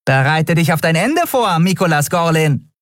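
high-voiced theatrical threat; mock menace. A narrator's reading. Nelen announces Miko's defeat at cards, mock menace. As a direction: pronounce doom with gleeful grandeur.

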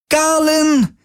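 very high, ringing cry for help; pained cry. Acted. Kirai calls to Galen in pain and fear. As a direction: cry out his name in pain. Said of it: "hallte Kirais Stimme".

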